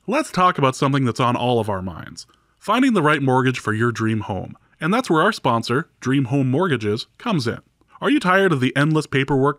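smooth promotional sponsor read. Acted. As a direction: read it like a podcast ad. Podcast host reads sponsor ad.

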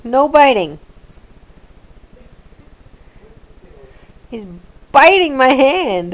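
loud, firm no to a pet; loud scolding. Real, not acted.

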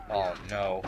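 slow, deadpan mourning; mock lament. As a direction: lament it slowly with feigned sorrow. Mock lament over run-over victims.

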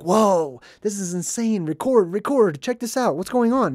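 thrilled at something new, urging record; excited. Retold or imitated. Vlogger imitating himself, eagerly excited. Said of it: "some people like me would be like".

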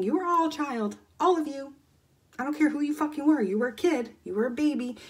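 emphatic insistence. Real, not acted. Coach insisting viewers were all children once, emphatic. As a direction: insist on it, voice climbing.

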